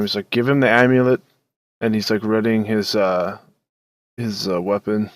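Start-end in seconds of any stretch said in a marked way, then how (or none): 0.3-1.2 raised, higher warning order. Acted. Game master's character warns Vic one more time, warning. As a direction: voice a firm last warning. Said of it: "warn Vic one more time".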